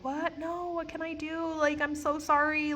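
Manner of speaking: high, pleading, panicked voice; shocked pleading. Retold or imitated. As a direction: beg to keep the job, high. Pleading after being fired.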